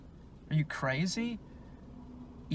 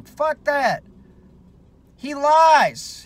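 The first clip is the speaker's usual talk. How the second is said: higher and louder, real anger; angry outburst; 10 semitones higher, 8 dB louder, much slower than usual. Real, not acted. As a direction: spit it out, fed up. Angry political rant.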